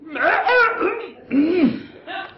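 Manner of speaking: hacking choke, voice squeaks up; choking cough. Acted. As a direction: choke loudly on the word. Choking again on the word.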